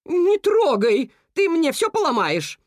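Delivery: angrier cuckoo. A narrator's reading. Cuckoo scolds moose, high, loud and slow. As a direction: scold him off the shopping. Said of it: "Ещё больше рассердилась Кука".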